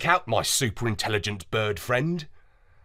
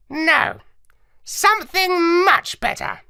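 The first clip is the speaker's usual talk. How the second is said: high squawky parrot, explaining; lecturing like a clever parrot; 4 dB louder, slower than usual. Acted. Parrot lectures the dog narrator, high and squawky.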